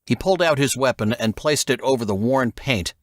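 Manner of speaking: ordinary delivery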